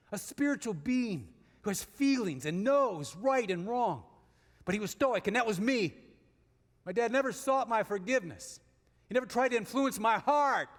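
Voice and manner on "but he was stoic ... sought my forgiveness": normal talking voice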